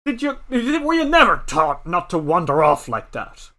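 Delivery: put-on old man scolding, some groans; lightly voiced cranky elder. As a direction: grumble and scold the child. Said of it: "and says".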